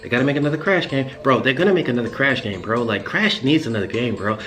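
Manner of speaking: lightly voiced eager young nerd, quick and a bit low. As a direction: rattle it off like an excited young fan. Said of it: "like, young Titus Nerd voice".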